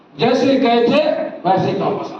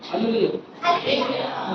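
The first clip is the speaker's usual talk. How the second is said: ordinary talk, nothing marked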